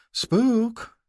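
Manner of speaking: high sing-song mother's call. Acted. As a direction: sing out his name. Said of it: "a sing-song voice said".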